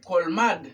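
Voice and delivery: "colmad" with the final o dropped; "colmad" ending on the d; learner's error. Retold or imitated. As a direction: imitate the mistake, "colmad" in two syllables. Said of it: "pero no es colmad".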